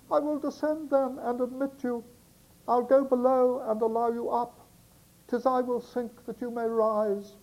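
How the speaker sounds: very high put-on girlish voice; coy, as a young girl letting him in; girl's voice. Girl answers the sailor at her door, high coy girl voice. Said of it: "the girl's voice for the girl".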